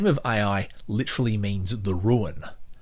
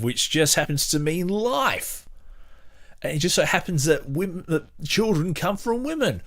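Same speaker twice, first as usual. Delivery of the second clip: sarcastic mock amazement; 9 semitones higher than usual. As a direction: pitch climbing higher and higher into exaggerated wonder.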